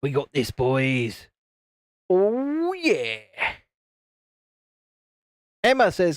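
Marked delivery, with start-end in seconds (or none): 0.0-3.2 put-on rally the boys like him; Vaughn voice. Shown as a demonstration. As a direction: streamer doing his teammate's pep talk. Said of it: "I think I've got my Vaughn voice down".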